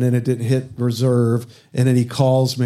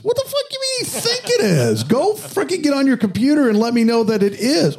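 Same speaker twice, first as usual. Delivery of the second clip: mock outraged yell, much higher; retold fury, pitched way up; angry retort; 14 semitones higher than usual. Telling off the service manager, in a high mock-angry yell.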